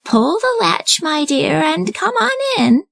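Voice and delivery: put-on high, slow old lady voice; high slow grandmother inviting her granddaughter in; kindly grandmother. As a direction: welcome her in like a sweet granny. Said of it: "said grandmother".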